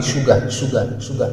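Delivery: "sugar" said the local way, softly; local African pronunciation. Shown as a demonstration. Teacher showing how locals in his country say sugar, softly. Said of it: "dans ma langue locale, on dit".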